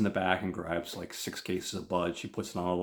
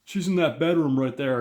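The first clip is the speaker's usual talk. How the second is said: higher, louder put-on thug voice; thug character; 7 semitones higher, 8 dB louder than usual. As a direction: play a thug loudly pointing the kid along. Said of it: "this guy that looks like a thug".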